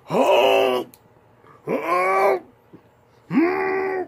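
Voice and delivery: high, loud choked-up whimpers; moved fan squeaking at the cute kid; overwhelmed cry. Retold or imitated. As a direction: squeal like you're about to cry. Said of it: "And I was like".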